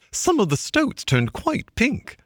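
high, slowed storyteller voice; Mole's excited tale. Acted. Mole boasting to friends, high and slow. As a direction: Mole savouring his triumph. Mole tells his adventure.

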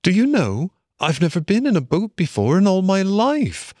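high, eager character voice; breaking exciting news in a high voice; excited Mole. A narrator's reading. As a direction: confide it eagerly, pitched well up.

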